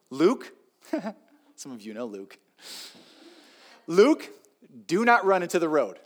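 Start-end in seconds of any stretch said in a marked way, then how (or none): 0.1-0.5 parent calling out. Acted. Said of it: "here's the command". 3.9-6.0 put-on louder, higher call to a child; parent's firm command. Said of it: "here's the command".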